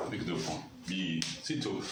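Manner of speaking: usual speaking voice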